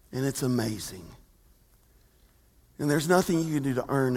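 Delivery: hushed reverent preaching; tender sermon hush. Real, not acted. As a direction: draw them in gently with grace. Preaching grace softly.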